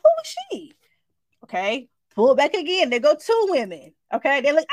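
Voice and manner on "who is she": high, crying-edged "who is she"; shocked question. Retold or imitated. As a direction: blurt it out in alarmed disbelief. Finding a woman behind curtain.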